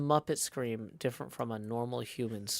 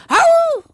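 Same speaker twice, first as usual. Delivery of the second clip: very high, loud yelping scream; Wilhelm scream; 20 dB louder than usual. Shown as a demonstration. Streamer attempting the Wilhelm scream, piercingly high. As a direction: scream it like a film stunt fall. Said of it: "how about the Wilhelm scream".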